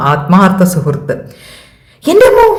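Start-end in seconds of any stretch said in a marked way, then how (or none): 2.0-2.5 sudden high loud yelp; startled cry. Acted. Manu startled, leaping up from his seat, high yelp. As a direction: jolt out of your seat with a cry.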